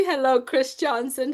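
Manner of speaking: composed, polite hello in an everyday pitch; restrained, courteous shout-out; proper greeting. Shown as a demonstration. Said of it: "That's more a proper way".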